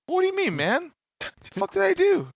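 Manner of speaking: high, squeaky baffled whine; gaslighting friend feigning confusion; mock confused friend. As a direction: play innocent, pitch way up.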